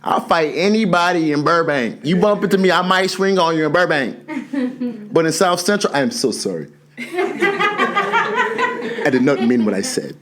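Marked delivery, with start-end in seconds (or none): 5.9-10.2 laughing apology. Acted.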